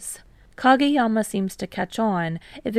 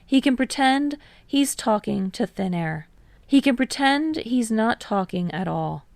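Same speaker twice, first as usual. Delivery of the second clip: ordinary delivery, nothing marked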